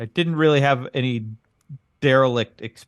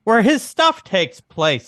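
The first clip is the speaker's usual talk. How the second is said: slow, higher-pitched, drawn-out correction; emphatic restatement; 7 semitones higher, 4 dB louder, much slower than usual. Real, not acted. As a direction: spell it out slowly, word by word. Repeats his interrupted phrase emphatically.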